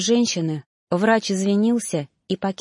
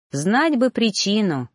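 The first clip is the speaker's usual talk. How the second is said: usual speaking voice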